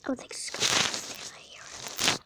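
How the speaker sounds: quick tense whisper; whispering while hiding. Real, not acted. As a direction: whisper fast, listening for steps. Hiding, hears footsteps.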